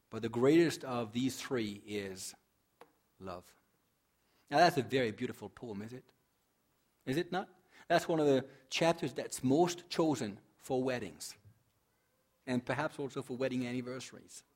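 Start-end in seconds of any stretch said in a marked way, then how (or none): none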